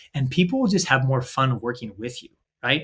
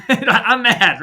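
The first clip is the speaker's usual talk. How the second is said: louder, higher mock-mad outburst; mock angry; 6 semitones higher, 4 dB louder than usual. Employee complaining to the boss, mock angry and louder. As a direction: ham up a grumpy employee, half-joking. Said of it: "Doesn't that sound like".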